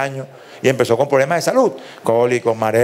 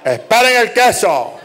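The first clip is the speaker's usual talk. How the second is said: mother's call; 5 semitones higher, slower than usual. Retold or imitated. Mother tells kids wait for cheese.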